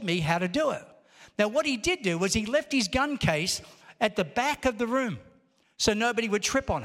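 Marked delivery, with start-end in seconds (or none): none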